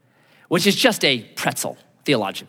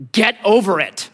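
blunt daughter; 4 semitones higher, 3 dB louder than usual. Retold or imitated. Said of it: "my daughter responded like this".

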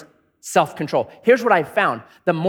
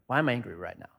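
lower, softer, reflective question; calm self-check; 8 semitones lower, 9 dB quieter, faster than usual. Shown as a demonstration. Preacher modelling the honest question, quietly. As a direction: ask yourself gently. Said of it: "unbelievable suspicion of my anger".

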